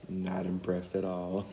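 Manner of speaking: ordinary delivery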